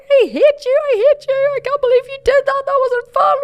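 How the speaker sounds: very high, loud screaming rant; furious sister. Retold or imitated. Sister with glasses knocked off, screaming at Kim. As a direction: scream blame at her. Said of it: "blaming me and getting really upset".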